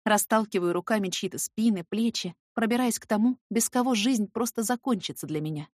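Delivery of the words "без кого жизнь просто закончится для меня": ordinary delivery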